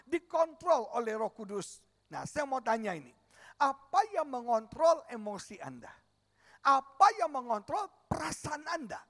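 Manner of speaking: loud revival preaching; preaching at full force. Acted. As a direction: drive it home to the congregation. Pastor exhorts congregation.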